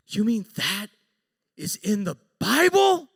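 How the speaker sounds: building to high, loud disbelief; incredulous. Retold or imitated. Coworker learning the verse is scripture, incredulous. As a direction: start measured, then burst out loud and high.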